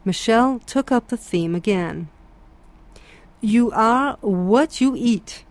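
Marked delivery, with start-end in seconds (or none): 3.4-5.4 slow, measured pronouncement that invites questions; inviting statement. A narrator's reading. Michel at breakfast, offering a theme to the table, slow and inviting. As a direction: lay it down slowly like a teacher waiting for questions. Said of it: "he stated with an air of inviting questions".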